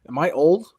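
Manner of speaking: normal talking voice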